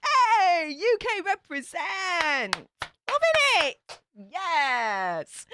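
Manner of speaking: high, bright cheer for the UK; excited cheer. Real, not acted. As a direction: cheer them on with delight. Cheering a UK viewer.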